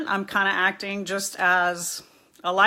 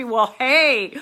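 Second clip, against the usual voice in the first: louder, upbeat cheering on; excited praise; 3 semitones higher, 6 dB louder, slower than usual. Real, not acted. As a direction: hype up a viewer in recovery. Praising viewer Dylan on his program.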